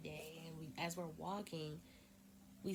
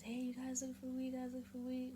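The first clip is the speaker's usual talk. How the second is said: random street dude; 7 semitones higher than usual. Retold or imitated. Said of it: "he just comes out of nowhere and approaches us".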